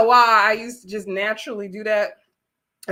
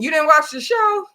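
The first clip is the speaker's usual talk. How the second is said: higher, louder retold challenge; mock disbelief; 6 semitones higher, 5 dB louder than usual. Challenging a cast member's convenient excuse, mock incredulous. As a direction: call her out in mock disbelief.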